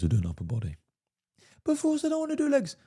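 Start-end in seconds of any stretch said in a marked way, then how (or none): 1.6-2.8 put-on high, whiny girlfriend voice. Girlfriend whining to Force about leg day in a high whiny voice. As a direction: whine it like a pouting girlfriend, high up. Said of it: "whiny girlfriend again".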